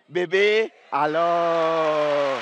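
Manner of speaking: put-on drawn-out, fond call of baby; imitating a couple's tender pet name; mock lover's call. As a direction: coo it like a lover calling his sweetheart. Tells the teacher the phrase.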